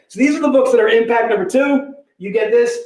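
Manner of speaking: usual speaking voice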